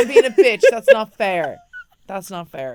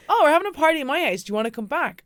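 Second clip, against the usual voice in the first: bright party invite; 7 semitones higher, 6 dB louder, faster than usual. Retold or imitated. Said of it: "And I'd be like".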